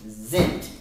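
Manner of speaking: Zent, said low and punched; stress on the second syllable. Shown as a demonstration. Tutor showing the child where the stress falls, punched. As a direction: hit Zent hard. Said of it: "which part is dark".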